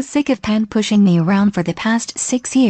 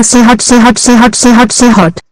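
frantic yell, much louder; screaming; 9 dB louder than usual. Acted. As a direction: scream it, mouth on fire. Mouth burning from hot sauce.

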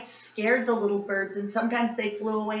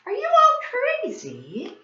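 much higher, slow, incredulous; astonished; 4 dB louder, slower than usual. A narrator's reading. Grandfather amazed at the shouting kids, incredulous. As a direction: ask it in amazed disbelief.

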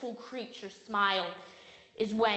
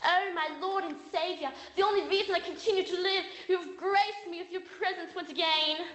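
high, gushing worship as an overjoyed dog; hammed-up rapture, pitched high; full craze mode; 6 semitones higher than usual. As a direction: adore your owner like a crazed dog. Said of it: "my dog will go full craze mode".

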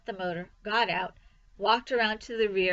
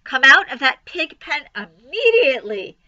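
loud, higher scolding order; cried command; 5 semitones higher, 11 dB louder than usual. A narrator's reading. Mother ordering son from pig pen, crying out. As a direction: order it sharply at full voice. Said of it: "cried Missus Arable".

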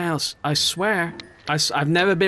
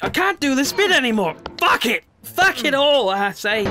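angry outburst; 8 semitones higher than usual. Real, not acted. Stuck player swears at the game, raised furious voice. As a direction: vent it at the screen.

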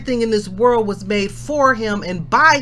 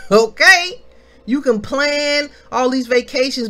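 higher, louder preaching push; fired-up preaching; 6 semitones higher, 4 dB louder, faster than usual. Acted. Preacher scolding viewers who plan vacations, fired-up preaching. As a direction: call them out about eternity.

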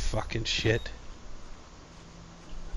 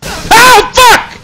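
very high, very loud shriek; screaming in fright; terrified scream; 18 dB louder than usual. Real, not acted. Jump scare in game.

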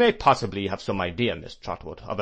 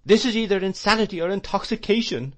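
agonised outrage; 11 semitones higher, 5 dB louder than usual. A narrator's reading. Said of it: "in a perfect agony".

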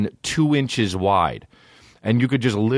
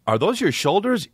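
high questioner voice; 10 semitones higher than usual. Pretend. Voicing a questioner in the bit.

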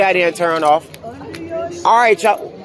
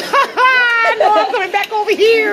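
mocking laugh. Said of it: "I'm like".

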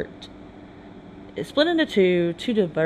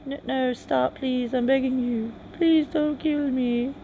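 higher, desperate mock begging; pleading; 6 semitones higher, slower than usual. Soldier begging his punishers to spare him, pleading. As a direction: beg for your life, a little hammed-up.

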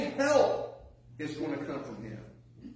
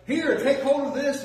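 rescuer calling; 9 semitones higher, 5 dB louder than usual. Retold or imitated. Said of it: "he's like".

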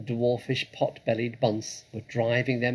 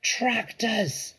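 high-pitched cry of alarm; shouted; 11 semitones higher than usual. A narrator's reading. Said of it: "shouted Mister Fox".

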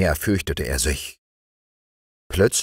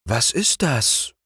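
awed king; 7 semitones higher, slower than usual. A narrator's reading. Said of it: "fragte der König".

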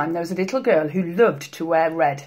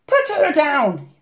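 higher, slow, forceful bellow; 9 semitones higher, 4 dB louder, slower than usual. A narrator's reading. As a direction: bellow an order as the woodcutter. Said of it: "bellowed the woodcutter".

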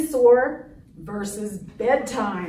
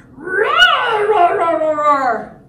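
high, loud, drawn-out roar; full dinosaur roar; 5 semitones higher, 8 dB louder, slower than usual. Acted. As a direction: let rip like a dinosaur. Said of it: "gonna be lots of roaring".

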